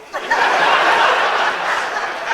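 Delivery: loud breathy burst of laughter. Acted. Old auntie bursting out laughing at her own joke. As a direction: let a big laugh burst out.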